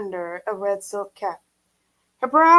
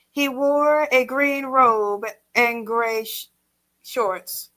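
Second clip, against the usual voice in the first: usual speaking voice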